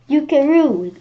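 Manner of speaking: loud high call of the name. Acted. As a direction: shout the name across the wall. Shouted name in story.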